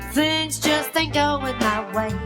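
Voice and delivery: plain reading voice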